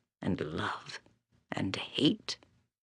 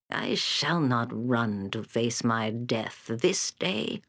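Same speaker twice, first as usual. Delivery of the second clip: ordinary talk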